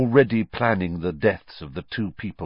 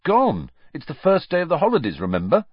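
put-on higher, louder, quick boyish voice; lightly voiced Ron, brisk; 11 semitones higher, 6 dB louder than usual. A narrator's reading. Ron reminding Harry it's the holidays, brisk and high. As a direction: toss it off fast like a teenage boy.

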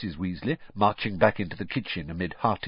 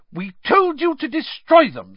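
furious, much higher and louder; 8 dB louder than usual. A narrator's reading. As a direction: tear into them furiously. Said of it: "said Missus Weasley furiously".

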